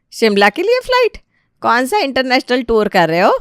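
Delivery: high mocking disbelief; incredulous. Wife mocking the uncle's flight idea, incredulous. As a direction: scoff at the very idea, pitch shooting up.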